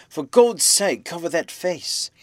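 high, loud, then drawn out; urgent plea. A narrator's reading. Man repeats his appalled demand.

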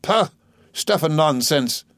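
mild mock scorn; aunt waving away Murdstone, scornful; scoffing. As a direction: brush him off with disdain.

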